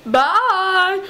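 high, drawn-out mock farewell. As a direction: stretch it out high.

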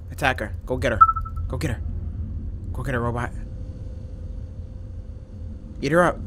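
ordinary talk

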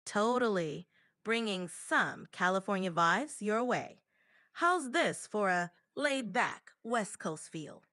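laid-back Californian drawl; California accent. Shown as a demonstration. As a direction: talk with an easy West Coast accent. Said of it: "How about California?".

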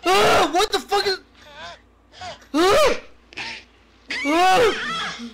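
high, loud real screams of fright; terrified screaming. Real, not acted. Streamer chased by a monster screams in terror. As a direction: scream out in panic. Monster appears in horror game.

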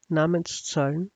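usual speaking voice

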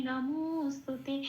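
নমোস্তুতে said slowly with the নমো drawn out; lengthened stress. Shown as a demonstration. Teacher showing the avagraha, stretching নমোস্তুতে slowly. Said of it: "এটাকে একটু জোর দিয়ে বলা".

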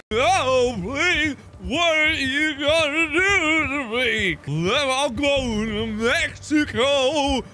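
high, slow pleading voice; mock pleading. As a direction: beg dramatically. Pleading in a put-on voice.

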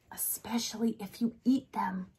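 a soft whispered punchline; whispered aside. Acted. Dad whispers joke to daughter, whispered.

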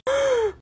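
sharp high gasp of delight; quick excited intake of breath. Real, not acted. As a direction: gasp as if stunned by the colour. Reacting to lipstick finish.

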